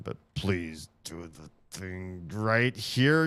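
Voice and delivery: drawn-out plea to the game; slow, hopeful begging; slow pleading. Real, not acted. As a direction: beg the puzzle slowly.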